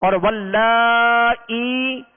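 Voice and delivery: वल्लाई with the ला drawn very long; stretched recitation. Shown as a demonstration. Teacher demonstrates stretching वल्लाई for students. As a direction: hold the vowel of वल्लाई slowly. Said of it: "हम खींचेंगे".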